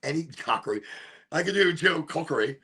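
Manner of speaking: ordinary talk, nothing marked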